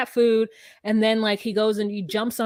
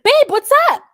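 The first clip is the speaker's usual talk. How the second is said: mock sweet call, much higher and louder; sweet girlfriend greeting; 9 dB louder than usual. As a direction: chirp it like the girlfriend pulling up. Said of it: "she's like".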